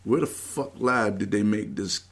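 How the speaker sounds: normal talking voice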